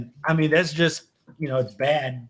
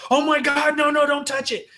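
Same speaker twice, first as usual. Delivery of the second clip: imitating a panicked shriek, much higher; hammed-up alarm, pulling the kid away; mock panicked mom; 11 semitones higher, 3 dB louder than usual. Mom sees her kid touching a snake, panicked shriek. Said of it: "freaks the fuck out. She's instantly like".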